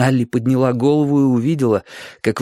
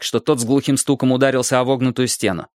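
plain reading voice

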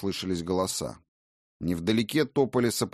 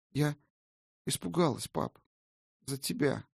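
put-on timid girl's voice; 7 semitones higher, 4 dB quieter than usual. Girl confesses her fear.

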